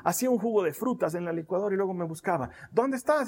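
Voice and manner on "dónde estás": 1 mother calling. Retold or imitated. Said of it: "mi mamá vivía persiguiéndome".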